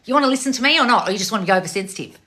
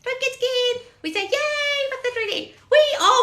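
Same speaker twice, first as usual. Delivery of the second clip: high pet voice. Real, not acted. Soothing her dog with a squeal.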